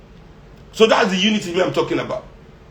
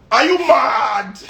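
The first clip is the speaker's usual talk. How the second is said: slow, laughing outburst, higher; laughing shout; 6 semitones higher, much slower than usual. Real, not acted. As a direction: shout it slowly through a laugh.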